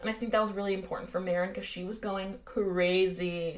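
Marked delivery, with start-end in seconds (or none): 2.5-3.6 slow, elongated "crazy" for emphasis; drawn-out "crazy". Real, not acted. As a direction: stretch "crazy" into cuh-RAY-zee.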